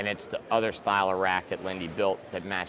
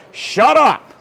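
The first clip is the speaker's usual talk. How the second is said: much higher and louder, slowing at the end; mock shout; 10 semitones higher, 11 dB louder, slower than usual. To complainers about modern racks, a hammed-up shout. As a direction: bark shut up like a fed-up old-timer. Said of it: "And I'm like".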